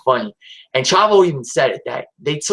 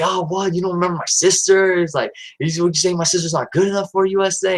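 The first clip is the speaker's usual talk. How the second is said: higher, mock offended hustler; a hint of an indignant brother, pitched higher; 6 semitones higher than usual. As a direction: retell Eddie playing the offended brother, pitched up. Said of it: "Eddie's like".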